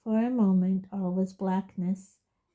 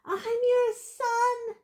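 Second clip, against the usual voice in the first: slow, high anguished scream; much slower than usual. Acted. Son screaming up at his father, slow high anguish. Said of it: "he screamed up at Crouch".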